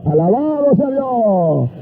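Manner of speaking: drawn-out, ringing preacher's exclamation; praise shout. Real, not acted. Preacher breaks into praise before the crowd, exultant. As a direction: cry out praise, stretching each word.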